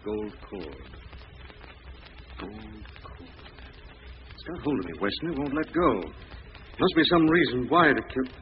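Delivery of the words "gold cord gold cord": musing murmur. Acted. Lamont lost in thought.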